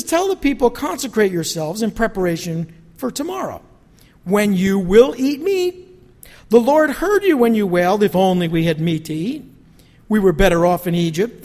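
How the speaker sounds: loud, proclaiming voice of God's words; reading God's rebuke aloud with full force; forceful scripture reading. Acted. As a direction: declare it like a prophet.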